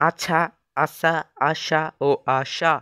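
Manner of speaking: আছা, then আশা slowly with s, sh and stronger sh; four sibilants. Shown as a demonstration. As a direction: set each আশা apart by its hiss, slowly. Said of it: "চার রকম উচ্চারণ হবে". (আছা থেকে আশা, চার রকম স-ধ্বনি ধীরে)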